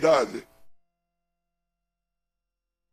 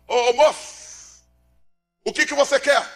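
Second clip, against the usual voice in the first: high, slow calling out to the man; called out; 9 semitones higher, slower than usual. Retold or imitated. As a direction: stretch the call to the man in the aisle. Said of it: "Raimundo Pereira gritou". (chamado alto e lento)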